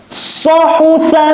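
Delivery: high, drawn-out chanting of the syllables; Quran recitation. Shown as a demonstration. Teacher recites for pupils to copy, high and drawn out. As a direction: chant it like a tajwid teacher showing the sound. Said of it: "Lihat Ustadz".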